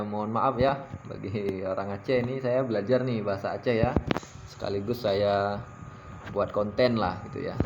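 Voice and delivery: ordinary delivery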